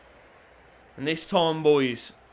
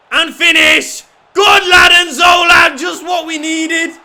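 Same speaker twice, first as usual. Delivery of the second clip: much higher, louder, full-throated roar of joy; goal celebration shout; 10 semitones higher, 15 dB louder than usual. Real, not acted. Cheering Zola's finish for his own side, a huge joyful roar. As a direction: yell it out as the ball hits the net.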